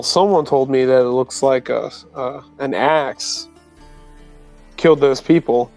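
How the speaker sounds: ordinary talk, nothing marked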